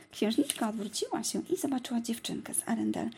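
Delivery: usual speaking voice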